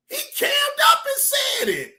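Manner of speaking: high, incredulous shout; excited shout. Real, not acted. Telling the audience, high incredulous shout. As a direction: yell it in disbelief.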